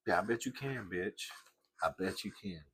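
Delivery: muttered sneer. Retold or imitated. Sneering at clerk in mock quote.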